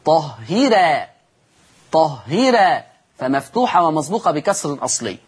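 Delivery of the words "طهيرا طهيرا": "طهيرا" said slowly with a light ra; thin ra. Shown as a demonstration. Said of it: "فتصير". (ترقيق الراء في "طهيرا")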